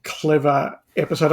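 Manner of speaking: ordinary delivery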